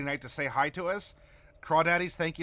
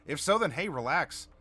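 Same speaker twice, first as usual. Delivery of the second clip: ordinary talk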